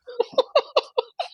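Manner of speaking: very high, pulsing hoots; starstruck hooting laugh. Retold or imitated. Fan told by the star they've met before, starstruck. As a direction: squeal out a string of tiny high laughs. Reacting to being recognised, retold.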